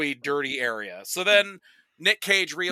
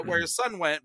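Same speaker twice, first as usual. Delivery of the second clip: ordinary delivery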